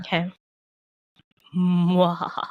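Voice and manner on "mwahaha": villain's mwahaha cackle; hammed-up evil laugh. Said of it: "you do your mwahaha".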